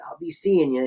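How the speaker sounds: a light knowing wink in the voice. Retold or imitated. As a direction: say it slyly, like a farewell wink. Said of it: "with a wink almost".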